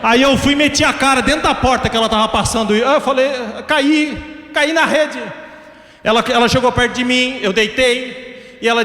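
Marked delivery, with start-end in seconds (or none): none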